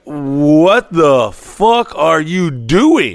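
very slow, drawn-out disbelief; baffled. Retold or imitated. Reacting to someone's audacity, baffled and hugely drawn out. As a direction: stretch every word as if caught off guard by sheer audacity.

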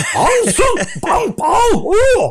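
mock angry outburst. Chief photographer raging at the crew, mock angry gibberish. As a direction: blurt out a burst of half-laughing fake curses. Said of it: "kom det noen sånne skikkelig sinnagloser".

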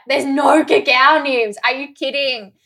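loud, drawn-out mock indignation; retold outrage. Retold outrage at missing smoothie topping.

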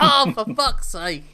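mock despair at the typo; exasperated groan. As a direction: drag it out like you've had enough. Host annoyed at typo again.

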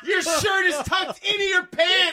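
high, loud, incredulous shouting; exasperated yell. Retold or imitated. Podcast host ranting at the movie character, loud and high. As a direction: yell in disbelief at the screen.

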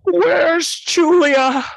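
very high, loud, slow anguished cry; mock frantic cry for a lost daughter; imitating Jodie Foster. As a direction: wail it like a frantic mother on a plane. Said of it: "where she goes".

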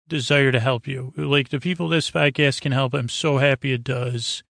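normal talking voice

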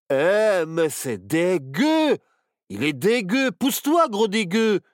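loud, high, grossed-out yell; disgusted. Acted. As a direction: shout it out in disgust. Disgusted at dog snot.

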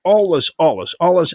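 mock triumphant repetition; excited echo. Real, not acted. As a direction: throw the word back with a grin. Echoes co-host with gusto.

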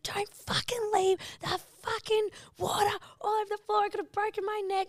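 high-pitched reenacted tirade; mock-hysterical telling-off; retold furious rant. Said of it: "you were seeing red".

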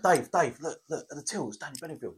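discreet hush. Retold or imitated. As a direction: urge him quietly, trailing off. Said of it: "being all discreet".

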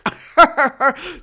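loud, high imitated cackling laugh; hammed-up high cackle; mock cackle. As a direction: cackle like the gossiping doctors. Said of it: "Two of them cackling away".

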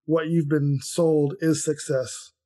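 normal talking voice

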